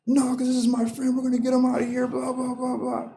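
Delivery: high, sustained mock protest; retold screaming. Friend refusing to leave his arrested buddy, high mock yell. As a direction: imitate a whiny-pitched shouted objection, tailing off. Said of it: "screaming at the cops".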